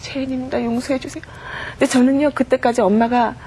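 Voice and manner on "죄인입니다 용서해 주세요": hushed, breathy repentant plea, softer; retold tearful prayer. Mother repenting before the pastor, in a hushed tearful plea. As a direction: murmur it like a broken confession. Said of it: "엄마가 십 분도 안 돼서 통곡을 하더라고요".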